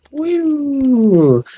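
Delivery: sudden squeaky whoop, way up high; high falsetto woo. Retold or imitated. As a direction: shriek it in a silly high voice. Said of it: "Sometimes he goes".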